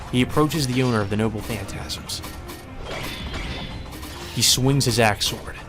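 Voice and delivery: usual speaking voice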